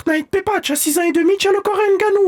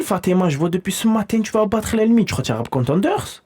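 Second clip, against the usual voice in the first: low dad voice; 10 semitones lower than usual. Acted. Voix grave de père.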